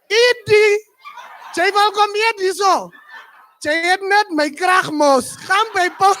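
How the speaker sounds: high, loud mocking scold; scolding girlfriend. Damara girlfriend mocks her beaten boxer, loud scolding. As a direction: tear into him in Afrikaans.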